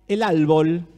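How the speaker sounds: "árbol" said as "álbol", the r turned to l; imitating a Chinese speaker swapping the r in "árbol" for an l; Chinese accent imitation. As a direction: mimic saying "el árbol" with an l for the r. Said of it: "el chino dice".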